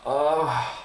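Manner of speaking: a high, pained ugh of disgust; fed-up groan. Real, not acted. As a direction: groan it out at the loss. Reacting to a big loss.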